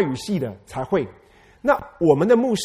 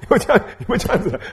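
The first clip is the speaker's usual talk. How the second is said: fast, laughing through the question; 5 semitones higher, 4 dB louder, faster than usual. Real, not acted. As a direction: giggle while asking. (笑着快速追问)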